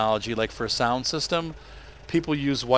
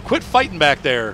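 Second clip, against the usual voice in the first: parental scolding; 4 semitones higher, 6 dB louder than usual. Real, not acted. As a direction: call over your shoulder to break up a fight. Tells kids behind to stop fighting.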